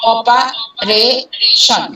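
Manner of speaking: Operation very slowly, broken into "o-pa-re-shon"; syllable-by-syllable stress demo. Shown as a demonstration. Teacher showing third-syllable stress, operation slowly split into syllables. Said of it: "আমরা সব উপরে জোর দিচ্ছি".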